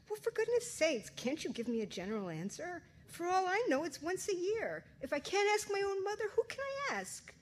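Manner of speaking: bellowing. Acted. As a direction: let it burst out in frustration. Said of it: "I bellowed".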